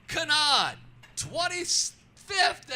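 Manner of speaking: loud, high announcer call, drawn out slowly; race call. Retold or imitated. Booth announcer calls Kanaan's position to the grandstands, loud and slow. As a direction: boom the name and position over the PA.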